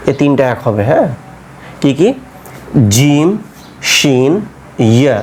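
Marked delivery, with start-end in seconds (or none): 2.9-5.2 "জিম" "শিন" "ইয়া" with the tongue raised to the palate; crisp palatal Arabic letters; teacher modelling palatal letters; Arabic jim shin ya. Shown as a demonstration. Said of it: "জিহ্বাটাকে ওপরের দিকে".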